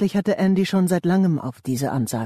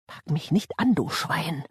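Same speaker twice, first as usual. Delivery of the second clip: softer, muffled protest; muffled curse; 3 dB quieter than usual. A narrator's reading. Gagged Andy recoils from Jakey's hand, muffled and angry. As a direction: curse him through a taped mouth.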